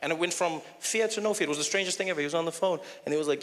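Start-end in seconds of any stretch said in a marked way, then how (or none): none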